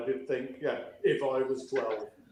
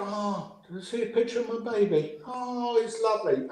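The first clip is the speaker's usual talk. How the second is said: mock gushing parent; 6 semitones higher than usual. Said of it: "And people go".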